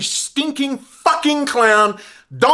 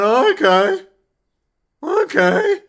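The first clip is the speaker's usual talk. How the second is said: higher, limp mock acceptance; mock meek okay; 6 semitones higher, slower than usual. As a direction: play America meekly going along. Mock America shrugging it off.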